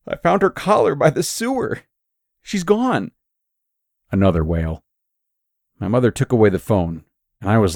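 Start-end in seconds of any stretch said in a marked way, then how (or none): 0.1-3.1 hint of grief, pitched up; moaning. A narrator's reading. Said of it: "Holly moaned".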